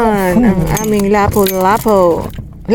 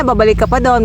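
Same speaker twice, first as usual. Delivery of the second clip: ordinary talk, nothing marked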